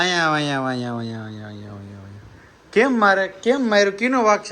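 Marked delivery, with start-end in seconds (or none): 0.0-2.6 repeated call, dropping lower and softer; fading call. Real, not acted. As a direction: coax her over, fading to a murmur. Calling the girl over repeatedly. (ધીમે ધીમે ઓછો થતો બોલાવો)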